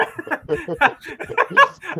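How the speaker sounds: high-pitched real laughing fit; helpless laughter. Real, not acted. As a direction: crack up, high and breathless. Cracks up at the response.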